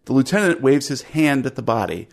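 ordinary talk

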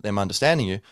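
usual speaking voice

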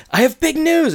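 excited announcement. Retold or imitated. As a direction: announce it thrilled, pitch way up. Excited announcement in retold scene.

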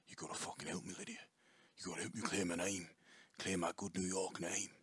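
soft, half-whispered mock Australian accent. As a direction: slip into a hushed imitation Aussie plea. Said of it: "the next sentence being like".

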